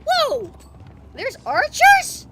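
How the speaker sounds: high, loud cries of shock; alarmed outburst. Real, not acted. Player sees his queen attacked, alarmed shouting. Panicked reactions as attack hits.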